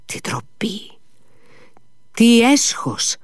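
high, drawn-out exclamation of disgrace; ashamed cry. A narrator's reading. Grushenka scandalised by the card cheating, ashamed cry. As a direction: start hushed, then cry out high in shame. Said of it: "φώναξε η Γκρούσεκνα".

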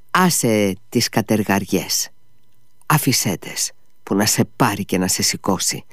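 ordinary delivery, nothing marked

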